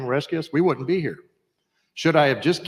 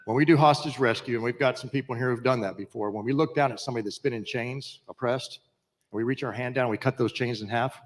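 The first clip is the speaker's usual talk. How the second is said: normal talking voice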